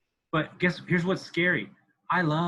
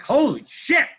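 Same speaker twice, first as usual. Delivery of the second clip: bursting with it, much higher and louder at first; a giddy shout of pride that eases off; excited self-praise; 4 semitones higher, 5 dB louder than usual. Retold or imitated. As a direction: cheer yourself on like you can't believe it. Quoting his own thrill on plane.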